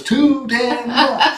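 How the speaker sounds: TOO DAMN MUCH drawn out, high and loud; playful emphasis. Real, not acted. Repeating a cat-count joke to laughing partner, TOO DAMN MUCH loud and slow.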